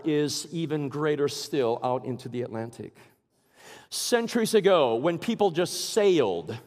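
ordinary talk, nothing marked

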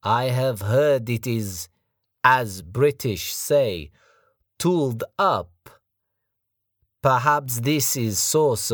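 put-on slow, high, loud taunting accent; menacing foreign thug. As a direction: sneer it like a big brute. Sergei continuing.

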